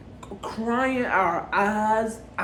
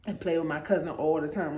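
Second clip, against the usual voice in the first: ordinary talk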